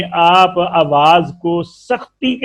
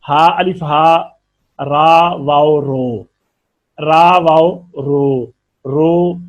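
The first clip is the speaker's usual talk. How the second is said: syllable by syllable, slowly spelled; slow spelling out; much slower than usual. Shown as a demonstration. Teacher spelling letters for students, slow and deliberate. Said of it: "अब इसको अगर जोड़ में लाए ना".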